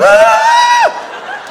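very high loud scream held long; panicked shark-escape scream. Retold or imitated. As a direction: scream running on water. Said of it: "screaming the whole way".

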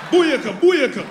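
loud high Jamaican dancehall cry; Jamaican shout. Retold or imitated. Comic as the Jamaican lover, loud dancehall patois cry. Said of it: "Jamaican broad".

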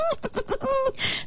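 very high, squeaky giggle; tiny creature cracking up; howling laughter. A narrator's reading. Flea and fly share the joke, howling with laughter. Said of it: "howling with laughter".

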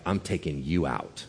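low, soft mock threat; quiet anger. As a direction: imitate an angry husband's menace, understated. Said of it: "And he is so angry".

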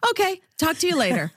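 high, fast mock mom brushing off; brisk mom. Imitating her mom ending the call quickly, brisk and high. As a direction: rattle off a quick goodbye like mom. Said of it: "And I'll say".

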